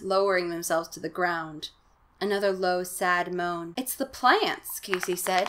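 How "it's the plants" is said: high, slow, mildly fearful line; understated storybook fright; frightened. A narrator's reading. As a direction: draw out the uneasy realisation. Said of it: "his expression frightened now".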